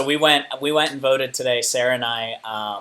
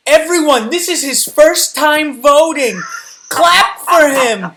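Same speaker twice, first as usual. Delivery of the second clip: much higher, loud, drawn-out proclamation; loud mock announcement; 13 dB louder, slower than usual. Comedian mimics poll worker announcing a first-time voter, loud proclamation. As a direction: call out to the whole room, imitating someone.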